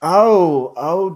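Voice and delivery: usual speaking voice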